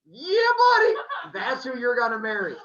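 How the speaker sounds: very high, loud, squealing 'Yeah, buddy'; hammed-up gloating Zeus. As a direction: crow it like a smug father god teasing his daughter. Zeus mocking Aphrodite's choice.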